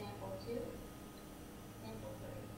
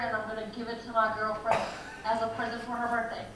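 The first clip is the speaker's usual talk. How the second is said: loud pushy infomercial pitch; hard sell ad; 4 dB louder than usual. Acted. Skit infomercial selling Sky So Beautiful.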